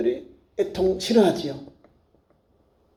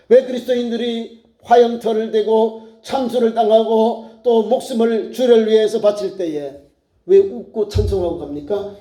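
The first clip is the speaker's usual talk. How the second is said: normal talking voice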